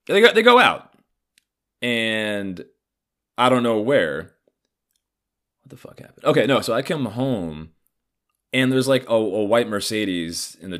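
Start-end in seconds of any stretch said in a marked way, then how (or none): 0.1-0.9 higher retake. Real, not acted. Repeated line, second take higher. 5.6-6.2 unvoiced whisper to himself; whispered aside. Real, not acted. Lost in his own story, whispering to himself.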